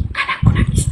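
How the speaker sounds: soft breathy mother whisper, fast; hushed mock mother whisper. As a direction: whisper so the baby sleeps. Mother whispers over baby.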